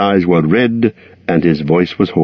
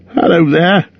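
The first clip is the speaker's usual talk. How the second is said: shaky call; 11 semitones higher than usual. A narrator's reading. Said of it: "he called with a shaky voice".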